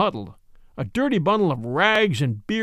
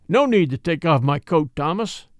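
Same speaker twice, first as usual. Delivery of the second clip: ordinary delivery, nothing marked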